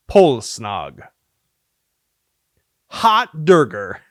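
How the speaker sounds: ordinary talk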